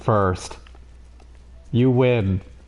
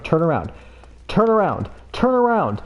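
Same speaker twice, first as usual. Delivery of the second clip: rising desperate plea; 5 semitones higher than usual. Real, not acted. Begging a game customer to turn back, rising desperate plea. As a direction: turn around, climbing higher each time.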